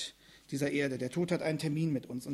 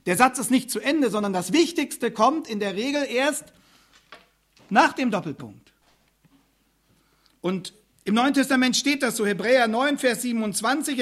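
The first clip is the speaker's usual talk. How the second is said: loud, higher, driving sermon delivery; preaching at full force; 7 semitones higher, 10 dB louder than usual. Acted. Preacher warning the congregation of Gottes Gericht, loud and urgent. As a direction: hammer it home from the pulpit.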